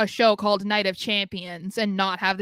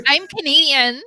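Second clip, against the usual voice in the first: mimicking friend; 6 semitones higher, 8 dB louder than usual.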